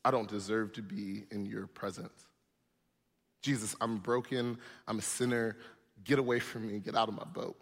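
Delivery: mild frightened plea to leave; Peter's fear. Retold or imitated. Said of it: "Peter would look at Jesus and go".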